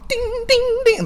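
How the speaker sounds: high chirpy game sound; ping it like a Mario Kart item; video game ding. Retold or imitated. Comic imitating a Mario Kart sound, high chirpy dings.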